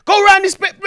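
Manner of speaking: yelling directions from the back seat, high and loud; panicked shouted directions in a chase. Retold or imitated. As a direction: bark the turns urgently. Shouting directions during police chase.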